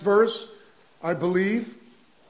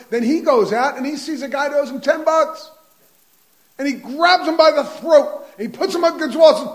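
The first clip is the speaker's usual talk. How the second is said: higher, louder, racing storytelling; preaching at full force; 8 semitones higher, 8 dB louder than usual. Acted. The preacher driving the parable home to his congregation, loud and fast. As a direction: build the scene hard and fast for the crowd.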